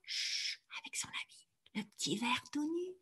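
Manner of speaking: tender hushed whisper. Shown as a demonstration. Teacher showing sleeping Gilles, soft whisper. Baby asleep, lullaby hush.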